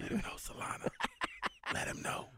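whispering. Real, not acted. Teasing aside to producer.

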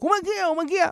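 high, eager call of the grandson; a child spotting the groom from the window, calling out excitedly; excited child's shout. Acted. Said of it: "והוא מתחיל לצעוק".

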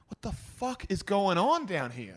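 incredulous. Retold or imitated. Brother amazed at the Melbourne pub, slow and high. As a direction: stretch it out in amazed disbelief. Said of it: "And my brother's like".

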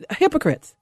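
normal talking voice

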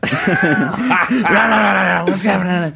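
high, loud hammed-up rah chant; mock inmate yelling. Imitating the whole cell block yelling, loud and high. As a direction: yell like a cage full of prisoners.

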